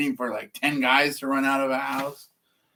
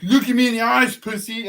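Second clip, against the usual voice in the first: higher, louder macho taunt; tough guy challenge; 9 semitones higher, 7 dB louder than usual. Retold or imitated. Worker dares the speaker, higher and louder. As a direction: stare him down with a jeer.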